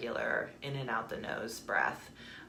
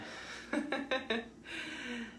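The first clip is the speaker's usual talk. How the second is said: light, quiet laugh; soft chuckle; 8 semitones higher, 7 dB quieter than usual. Real, not acted. Joking about beating dead horses, soft chuckle. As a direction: chuckle lightly at your own remark.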